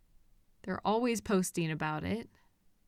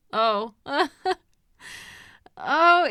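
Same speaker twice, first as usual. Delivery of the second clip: higher, louder flustered giggle and "oh"; embarrassed laugh; 5 semitones higher, 6 dB louder than usual. Acted. Captor giggling, embarrassed at being recognised.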